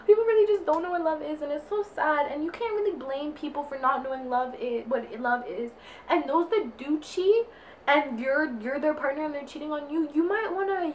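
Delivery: normal talking voice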